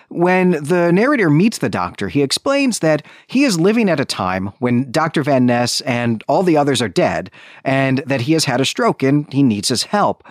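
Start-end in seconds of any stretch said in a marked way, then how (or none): none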